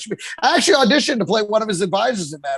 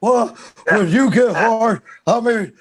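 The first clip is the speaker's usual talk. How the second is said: mock Southern accent, words drawn out; badly faked Southern drawl accent; Bob Sapp accent; slower than usual. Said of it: "with a Bob Sapp accent".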